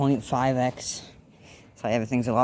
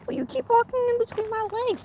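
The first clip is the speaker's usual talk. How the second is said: pet voice. Real, not acted. Cat walking between his legs, very high squeaky scolding.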